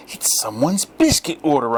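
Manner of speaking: bright excited announcement; enthusiastic. A narrator's reading. Narrator excitedly telling the youths what he heard. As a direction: say it wildly, eager. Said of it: "wildly, enthusiastically".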